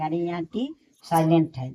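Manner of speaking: usual speaking voice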